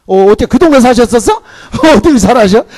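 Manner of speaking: loud, high, laughing question; laughing tease. Real, not acted. Preacher laughing at a congregation member, loud and high. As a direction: tease a listener through laughter.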